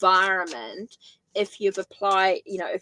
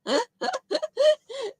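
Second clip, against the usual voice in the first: high laugh. Real, not acted. Laughs at own mumbling.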